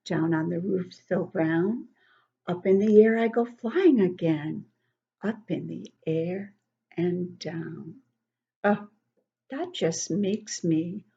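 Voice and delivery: normal talking voice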